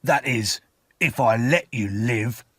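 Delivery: rough convict's veiled threat; said roughly. A narrator's reading. Convict questioning the boy, rough threat. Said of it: "he said roughly".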